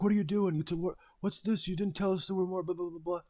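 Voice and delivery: high-pitched, exasperated mock yell at the workers; hammed-up high squawk of a frantic worker; mock angry yelling. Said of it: "He's yelling at us".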